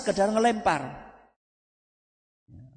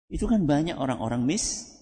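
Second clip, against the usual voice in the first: ordinary delivery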